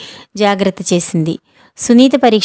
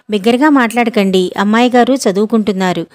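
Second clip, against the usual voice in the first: usual speaking voice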